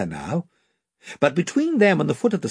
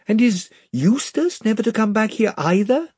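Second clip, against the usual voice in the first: put-on higher, eager young girl asking; a hopeful girl's question; Lucy asks the lamb the way, eager and high; 8 semitones higher than usual. A narrator's reading. Lucy voiced.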